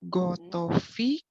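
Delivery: ordinary delivery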